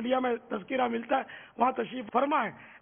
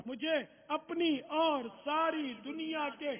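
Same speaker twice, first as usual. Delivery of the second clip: slow, soft, higher-pitched intoning; recited motto; 4 semitones higher, 8 dB quieter, slower than usual. Real, not acted. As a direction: intone each word slowly as a solemn pledge.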